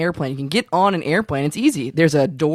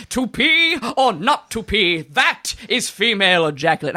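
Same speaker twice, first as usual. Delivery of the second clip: slow, drawn-out theatrical declamation; mock Shakespearean; 4 semitones higher, slower than usual. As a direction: announce it like a grand stage soliloquy. Mock Shakespearean topic announcement.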